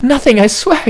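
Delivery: panicked squeak. Acted. Squeaky panicked denial, reader character.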